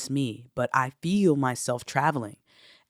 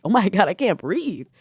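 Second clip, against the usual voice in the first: higher-pitched re-enacted gasping plea; overwhelmed, struggling to breathe; 6 semitones higher, 4 dB louder than usual. Speaker retelling catching the spirit, re-enacted overwhelmed cry. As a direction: act out being too overwhelmed to breathe. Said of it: "I'm just trying to breathe".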